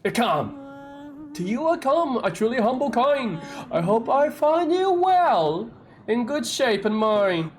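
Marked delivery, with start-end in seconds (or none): 1.4-7.5 higher, slow, grandiose rhyme; a hint of a theatrical bard reciting; lightly voiced flamboyant poet. Voicing poet's rhymed greeting.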